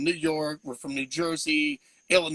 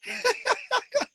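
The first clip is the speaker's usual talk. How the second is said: high laugh. Real, not acted. Laughs mid-question.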